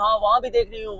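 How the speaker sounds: understated mock of a speaker pointing around; mocking Maryam Nawaz. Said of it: "और इशारा कर रही".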